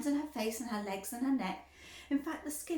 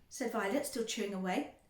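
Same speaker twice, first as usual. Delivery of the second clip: usual speaking voice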